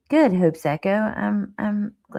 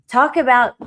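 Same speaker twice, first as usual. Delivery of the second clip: emphatic repeat; 6 semitones higher, 7 dB louder than usual. Real, not acted. Repeats phrase, second time louder.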